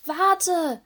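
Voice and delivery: loud, high storybook shout; yelling after someone. A narrator's reading. Little mermaid to the sister ahead of her, yelling. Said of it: "brüllte Serena ihrer Schwester hinterher".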